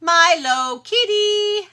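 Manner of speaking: high, slow cat-calling voice. Real, not acted. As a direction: call the kitty sweetly and slowly.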